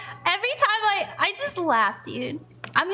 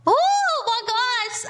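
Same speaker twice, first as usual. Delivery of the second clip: dizzy gasp; 7 semitones higher, 4 dB louder, slower than usual. Real, not acted. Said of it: "I just had a wave of dizzy".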